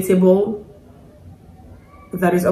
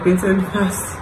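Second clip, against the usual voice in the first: normal talking voice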